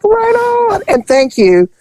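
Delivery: very high, hammed-up cheery voice; mock cheerful. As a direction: chirp it like a happy kid. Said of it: "I was like".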